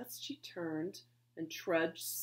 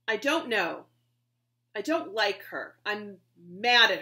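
sulky; 10 dB louder than usual. Acted. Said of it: "all she could do not to cry".

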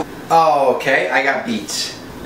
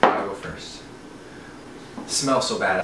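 hushed dread; 6 semitones lower, 8 dB quieter than usual. Real, not acted. Dreading the drink.

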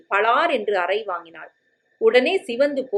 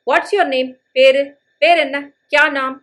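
calling out to her; 5 semitones higher, 6 dB louder than usual. Acted. Men calling to girl in languages.

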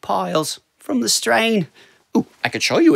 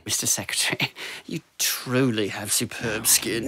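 fawning whisper; 8 semitones lower, 7 dB quieter than usual. Acted. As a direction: whisper it like a courtier. Fawning clerk flatters secretary.

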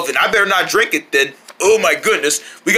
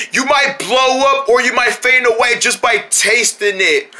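ordinary delivery

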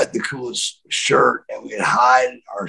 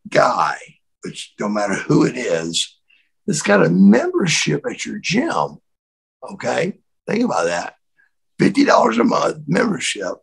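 ordinary talk, nothing marked

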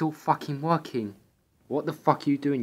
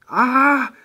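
high yelp; 12 semitones higher, 10 dB louder than usual. Acted. Sudden fall in snow.

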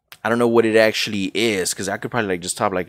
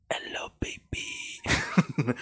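ASMR whisper; 9 dB quieter, much slower than usual. Pretend. Mock ASMR greeting.

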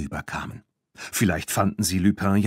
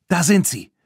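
higher, a bit louder call; 8 semitones higher, 4 dB louder than usual. A narrator's reading. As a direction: spot the car and call to friends. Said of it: "rief Claire".

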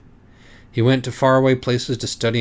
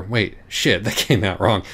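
ordinary delivery

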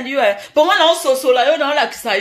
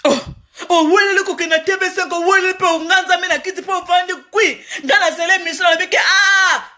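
exclaiming; 6 semitones higher than usual. Real, not acted. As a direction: wail it out, pitched up high. Loud lament at the singer's conduct.

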